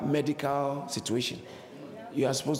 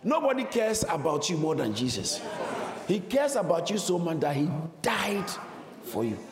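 fervent preaching; 4 semitones higher than usual. Acted. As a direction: preach it with fire. Preaching at full force.

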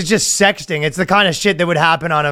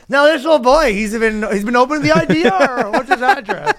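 high-pitched mock-casual prying; over-interested confessor; 9 semitones higher than usual. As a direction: fish for details in a raised, put-on voice. Acts over-interested confessor probing.

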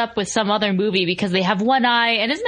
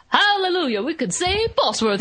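put-on changed-voice mole kid; 7 semitones higher than usual. Host retelling the little mole kid's outburst, mock preacher voice. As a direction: imitate the kid turning revivalist. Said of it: "He, like, just changes his voice".

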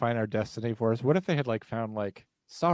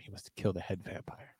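softer, whispered dramatic warning; spooky whisper; 4 semitones lower, 14 dB quieter than usual. Acted. Host telling listeners how to destroy vampires, in a spooky whisper. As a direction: lean in and whisper it like a secret curse.

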